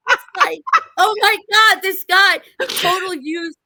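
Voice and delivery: real amusement spilling into speech; laughing. Real, not acted. Laughing while retelling the doctor.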